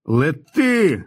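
high, drawn-up urging yell; bawling. A narrator's reading. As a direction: bawl at the car to fly. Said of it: "горлав пацан".

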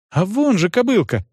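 put-on high, eager girl; lightly voiced glad discovery; joyful exclamation. A narrator's reading. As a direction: point it out with sudden delight. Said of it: "радостно воскликнула девочка".

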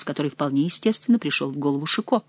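usual speaking voice

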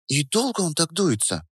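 put-on high, hushed girlish voice; secretive, soft and high-pitched; stage whisper. A narrator's reading. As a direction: ask softly so he won't hear. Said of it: "спросила Дарья шёпотом".

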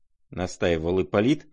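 plain reading voice

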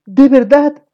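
excited question. A narrator's reading. Boy thrilled at mother's baby news, excited. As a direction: burst out in delight.

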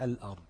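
الأرض with the ل clearly heard; lam pronounced. Shown as a demonstration. Teacher correcting the dropped lam, الأرض with clear L. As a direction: sound a distinct L, then the hamza in الأرض. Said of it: "يبقى اللام تنطق".

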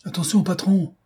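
high-pitched, frightened warning; alarmed. A narrator's reading. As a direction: warn the boss in sudden alarm. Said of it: "dit Octave effaré".

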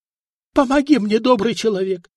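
high, loud frightened begging; desperate cry for help; teeth chattering plea. A narrator's reading. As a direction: beg for help, teeth rattling. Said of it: "простучал зубами ростовщик".

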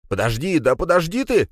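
high, urgent protest; pleading shout. A narrator's reading. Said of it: "кричал уже протрезвевший Пегасов".

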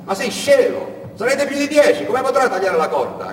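high, loud scoffing smuggler voice; the fat smuggler laughing at the boy; mocking smuggler. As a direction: sneer at him from up high.

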